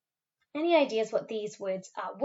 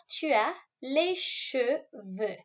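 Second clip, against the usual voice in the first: tu as les cheveux dragged out slowly, word by word; slow spelled-out French; 3 semitones higher, much slower than usual. Shown as a demonstration. Teacher breaking down a French phrase for pupils, very slow. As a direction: stretch tu as les cheveux out for the class. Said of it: "So you have".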